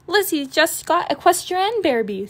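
put-on high, bright girl voice; eager young cub bragging; little sister. Sister cub bragging to Mama about Lizzie's toy, high girlish voice. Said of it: "said Sister".

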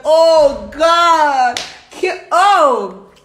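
loud, high drawn-out exclamation; acted ecstatic cry. Partner overcome in her retelling, loud ecstatic cry. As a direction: cry out, hammed up.

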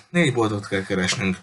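ordinary delivery

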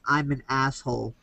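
pinched-nose repeat of an instructed phrase; holding his nose. Retold or imitated. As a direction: say it with the nose held shut. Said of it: "hold your nose like this and say".

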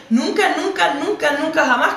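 "Nunca, nunca" pitched high, each repeat punched; emphatic repetition. Real, not acted. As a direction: hammer every "nunca" with a high, insistent peak. Insisting she never imagined the reason. (énfasis insistente en cada "nunca", con tono agudo)

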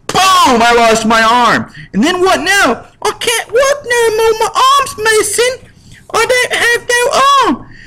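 cartoonish high kid lamenting his lost arm; hammed-up child voice. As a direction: whimper like a maimed little orphan. Child loses arm at factory.

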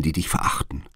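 breathy whisper, dropped low. A narrator's reading. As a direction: let it fall to a secret whisper. Nurse names the scornful maids. (geflüstert)

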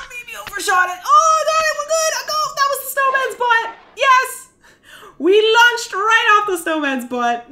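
high, squealing disbelief and relief; high-pitched triumphant babble; giddy excitement. Real, not acted. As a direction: gush up high, amazed at the lucky save. Thrilled by a lucky launch.

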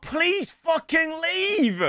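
high, slow, begging whine; slow, whiny high plea; exasperated plea. Real, not acted. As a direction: drag out the plea in despair. Begging an unwanted player to go.

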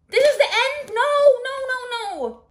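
very high, loud cry of denial; shocked outcry. Real, not acted. Reader finding the book has ended, shocked outcry. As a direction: shriek it, then keep refusing.

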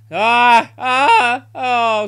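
loud, high cries of frustration; frustrated yell. Real, not acted. Gamer yelling at a near miss, loud and high.

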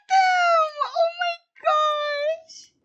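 high, loud, drawn-out squeal; squealing excitement. Real, not acted. Player gushes over the ghost couple, squealing with excitement. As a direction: squeal it, overcome by how sweet it is.

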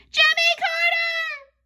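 very high, loud, drawn-out shout; stretched high-pitched cry of an answer. A narrator's reading. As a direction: blurt the answer slowly and high. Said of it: "shouted Andrea".

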